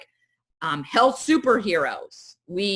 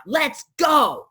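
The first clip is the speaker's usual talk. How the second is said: higher, louder shout of let's go; rallying shout; 6 semitones higher, 4 dB louder than usual. Retold or imitated. Over-functioners organizing everything, rallying shout. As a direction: fire up the troops. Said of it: "they're like".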